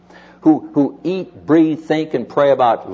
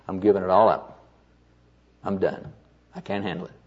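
lower, softer, mildly defeated; retold resignation; 5 semitones lower, 5 dB quieter than usual. Burned-out friend tells Leroy he quits, mildly resigned. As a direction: trail down softly, as if giving up.

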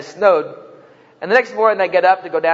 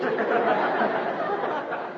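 laughing; 8 semitones higher, 9 dB quieter than usual. Real, not acted. Laughing at own joke.

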